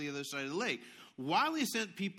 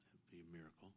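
much softer, low and longing; hushed reply; 12 semitones lower, 25 dB quieter, faster than usual. Acted. Preacher answering the child's wish in a hush. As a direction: barely say it, like a hope.

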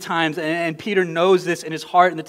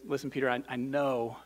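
soft, gentle voice of forgiveness; tender reassurance to a friend; loving; 4 semitones lower, 10 dB quieter than usual. Retold or imitated. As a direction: say it lovingly and quietly. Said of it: "lovingly and says".

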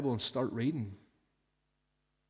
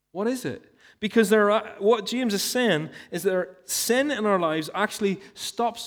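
loud, higher, fervent preacher drive; preaching at full force; 5 semitones higher, 5 dB louder than usual. Acted. Preacher urging the church to hear God, forceful preaching. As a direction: press the point home from the pulpit.

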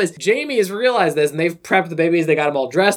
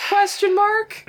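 very high-pitched spoken punctuation; exaggerated high questioning voice; mock bafflement; much slower than usual. Real, not acted. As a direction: squeak it out in comic disbelief.

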